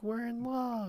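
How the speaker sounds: high, breathy-free swoony mock line; mock lovestruck couple. Podcaster mocking the new couple, swooning mock voice. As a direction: coo it like a smitten couple.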